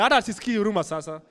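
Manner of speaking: usual speaking voice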